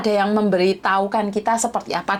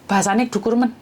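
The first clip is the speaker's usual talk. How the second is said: ordinary talk, nothing marked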